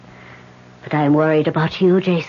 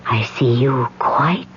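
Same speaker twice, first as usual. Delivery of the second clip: slow whispered trance; 4 semitones lower, 6 dB quieter, slower than usual. Acted. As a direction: whisper as if seeing through a blinding light. Medium enters trance.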